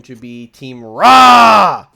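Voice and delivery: booming announcer yell; hype shout. Acted. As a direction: belt the team name out. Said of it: "I hope that picked up".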